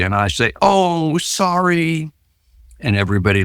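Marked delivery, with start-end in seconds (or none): 0.6-2.1 high, drawn-out fake sympathy; mock pity. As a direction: tease him with a pitying "sorry". Mock pity for New Jersey.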